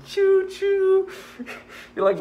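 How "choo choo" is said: high, squeaky choo choo of a train whistle; playful high train whistle; train whistle imitation. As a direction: toot it out like a tiny locomotive. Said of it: "You just hear the locomotive whistle".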